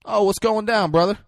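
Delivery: hammed-up casual hello; super casual greeting. [name] greeting Lonzo as he walks in, super casual. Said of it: "super casual".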